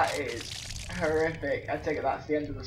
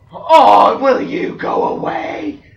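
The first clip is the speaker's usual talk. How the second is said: high, loud, drawn-out yell; exasperated shout; 11 semitones higher, 14 dB louder, much slower than usual. Real, not acted. Player yelling at the game to go away, exasperated shout. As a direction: yell it slowly, high above your usual voice.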